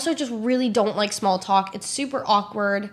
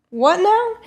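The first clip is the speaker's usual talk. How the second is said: incredulous; 9 semitones higher, 6 dB louder than usual. Retold or imitated.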